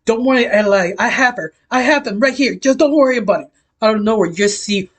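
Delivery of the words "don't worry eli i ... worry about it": high-pitched, mildly raised cry; acted half-scream of reassurance. A narrator's reading. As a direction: call out urgently, pitch climbing. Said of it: "you just hear Parasite screaming".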